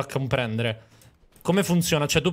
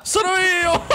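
high shouted call to a teammate; excited high yell; 14 semitones higher, 4 dB louder, much slower than usual. Real, not acted. Calling out to teammate in game.

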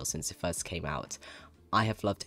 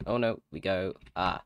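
ordinary delivery

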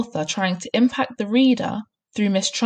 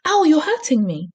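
high, louder complaint of pain; pained cry; 9 semitones higher, 5 dB louder than usual. A narrator's reading. Boy to headmistress pinching his ears, pained cry. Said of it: "Eric cried".

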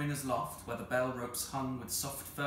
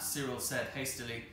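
ordinary delivery

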